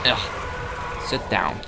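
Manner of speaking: normal talking voice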